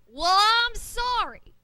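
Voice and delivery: loud, slow, huffy pirate; loud defensive. Acted. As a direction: shout back defensively.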